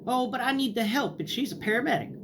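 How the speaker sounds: high, sing-song grandma reply; lightly voiced innocent grandmother; mock sweet grandma.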